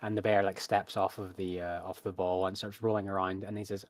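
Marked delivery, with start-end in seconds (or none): none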